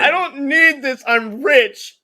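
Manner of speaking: loud, high rich-man tantrum; mock outraged millionaire; indignant boasting. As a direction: throw a hammed-up fit, high and loud. Acting rich man rejecting the game.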